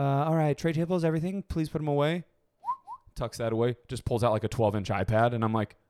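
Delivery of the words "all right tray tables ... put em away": flight attendant voice. Retold or imitated. Imitating flight attendant announcement.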